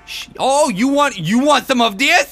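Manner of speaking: high, loud mock-tough yelling; loud taunts. Player taunts game enemies in a shootout, high loud yelling.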